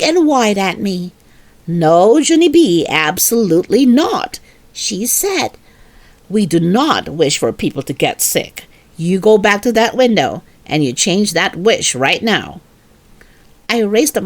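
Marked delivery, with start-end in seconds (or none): 1.7-4.4 stern mother. Acted. Mother figure scolding. 6.3-12.6 low, firm scolding mother voice; stern mother. Acted. Mother refusing Junie B's bedtime wish, low and stern.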